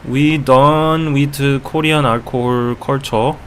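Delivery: put-on slow Korean-accented English; careful, halting Korean accent. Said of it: "with the Korean accent".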